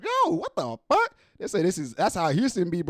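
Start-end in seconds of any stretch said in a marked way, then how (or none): 0.0-1.2 much higher, slow stretched disbelief; shocked yelp. Retold or imitated. Voicing the shocked guy, a high stretched yelp. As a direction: squeal it in disbelief. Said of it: "you like".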